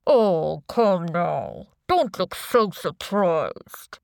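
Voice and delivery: slow, disguised villain voice; anonymous boss, drawn out; deep, distorted voice. A narrator's reading. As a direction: chide him through a voice changer. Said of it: "distorted voice".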